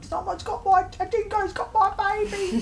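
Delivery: hammed-up dingo line in a Kiwi accent; New Zealand movie accent. Actress quoting her own film line in a New Zealand accent. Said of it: "the New Zealand Australian one".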